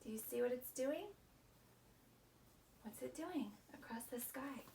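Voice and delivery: plain reading voice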